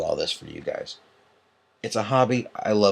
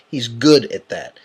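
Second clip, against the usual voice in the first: sarcastic; 7 dB louder, slower than usual. Retold or imitated. Sarcastic concession about admin.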